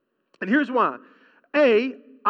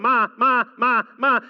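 mock toddler whine, louder; whining toddler; 4 dB louder than usual. Parent imitating a toddler, louder whining. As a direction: imitate a kid whining for mom. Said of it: "and whining and whining".

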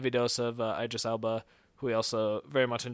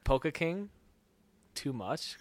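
POLKA KING lifted into a question, then a pause before TOO MUCH; questioning tune; 3 semitones higher, 3 dB quieter than usual. Shown as a demonstration. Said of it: "Question mark".

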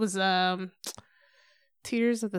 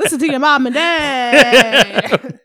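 scolding parent voice; 3 semitones higher, 11 dB louder, much slower than usual. Retold or imitated. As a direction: drag it out like a preachy parent. Joining the Family Guy bit.

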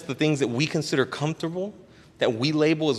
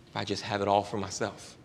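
ordinary talk, nothing marked

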